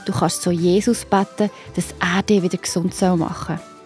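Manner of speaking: usual speaking voice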